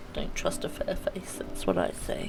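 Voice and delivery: hushed conspiratorial whisper; whispered aside. Real, not acted. Streamer whispering a suspicious aside to chat. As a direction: lean in and whisper the warning.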